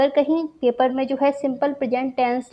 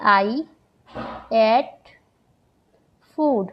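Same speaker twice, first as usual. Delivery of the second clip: "I ate food" with long pauses between words; much slower than usual. Shown as a demonstration. Teacher to students, spacing out "I ate food" word by word. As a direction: space out "I", "ate", "food" one by one. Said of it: "ये मैंने एक example दिया".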